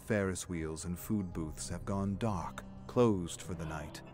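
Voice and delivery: ordinary delivery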